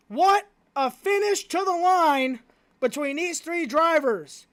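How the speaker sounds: normal talking voice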